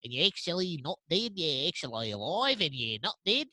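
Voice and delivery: put-on Australian-accented Alfred rambling on; doing Alfred as an Australian butler. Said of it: "It's an Australian butler?".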